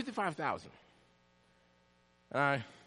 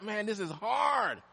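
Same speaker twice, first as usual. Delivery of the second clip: slow weary groan; 8 semitones higher, slower than usual. Retold or imitated. Retold despair, slow.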